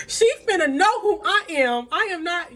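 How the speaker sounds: high, loud defiant declaration; defiant vow. Retold or imitated. Vowing to out the affair to the wife, defiant.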